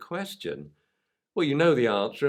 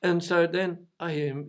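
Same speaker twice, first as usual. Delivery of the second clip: ordinary talk, nothing marked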